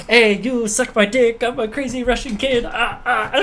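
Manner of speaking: high, slow, hammed-up raver voice; mock a wild Russian party kid; crazy Russian kid voice. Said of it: "my girlfriend is a raver".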